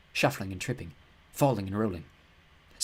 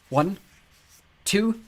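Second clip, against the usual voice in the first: rising, louder count; counting down before the fire; tense count; 10 semitones higher, 5 dB louder than usual. A narrator's reading. Girl counts to Walt, tense and rising.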